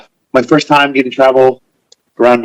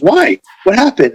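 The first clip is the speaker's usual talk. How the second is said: mock alarm; 10 semitones higher than usual. Retold worried question to Savage.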